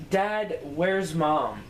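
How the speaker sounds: ordinary talk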